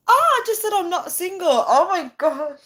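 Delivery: high yelp of realisation, then oh my gosh; embarrassed surprise. Real, not acted. Streamer realises she told viewers she's taken, embarrassed squeal. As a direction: catch yourself mid-slip.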